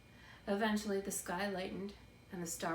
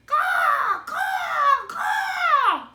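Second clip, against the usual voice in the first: very loud, very high crow caws; crow screaming; 17 dB louder, much slower than usual. A narrator's reading. Big crow screaming at Edward, very loud and high. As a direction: shriek like an angry crow. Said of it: "screamed a dark message".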